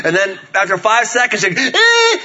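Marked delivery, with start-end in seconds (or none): 1.7-2.2 very high, loud imitated squeal; child's squeal. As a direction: let out a kid's piercing ride squeal. Imitating daughter five seconds later.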